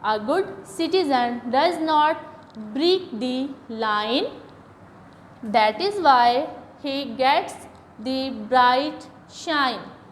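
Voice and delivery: very slow, word by word, each sound clear; slow model reading. Shown as a demonstration. Teacher reading the line to pupils, very slowly. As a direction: read the line out like a teacher for beginners. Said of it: "ये दो lines पढ़ी हैं".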